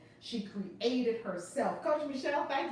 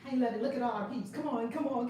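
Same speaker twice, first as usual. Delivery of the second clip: ordinary talk, nothing marked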